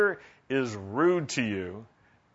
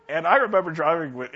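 ordinary talk, nothing marked

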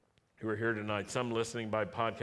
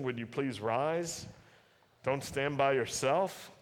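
ordinary delivery

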